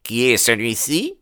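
abrupt question rising at the end; brusque. Acted. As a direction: demand to know who the stranger is. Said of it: "demanda-t-il brusquement". (question brusque, intonation montante)

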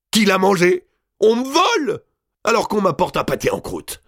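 high, loud indignant shriek; outraged countess. A narrator's reading. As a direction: accuse the household of stealing. Countess furious at theft.

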